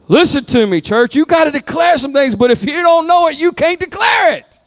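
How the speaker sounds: high, loud, fervent preaching; preaching at full force. Real, not acted. Pastor urging the church to declare, shouting. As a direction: build it up and call out to the church.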